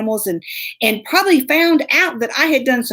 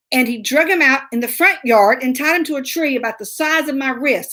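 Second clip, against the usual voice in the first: normal talking voice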